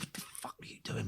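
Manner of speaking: voiceless, hushed mock confrontation; whispered outburst. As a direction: act out a furious challenge under your breath. Retelling storming into the studio.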